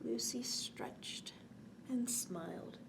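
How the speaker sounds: softly whispered narration. Acted. Lulling a child toward sleep, whispering. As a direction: hush down as if she sleeps.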